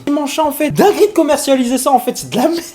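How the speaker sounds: high, loud outraged rant; shocked disbelief, voice shooting up; outraged disgust. Real, not acted. Outraged after tasting.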